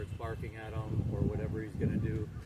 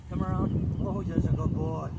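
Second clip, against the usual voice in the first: firm louder command; 6 semitones higher, 8 dB louder, slower than usual. Real, not acted. Commanding then praising dog.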